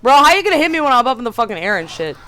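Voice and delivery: high, loud, fast indignant rant; outraged complaint. Real, not acted. Streamer hit mid-air by the boss, outraged fast yell. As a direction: yell at the unfair game.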